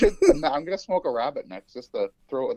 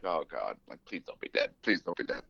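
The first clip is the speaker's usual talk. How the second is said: hushed plea; 10 semitones lower, 9 dB quieter than usual. Retold or imitated. Retold panicked plea to rabbit.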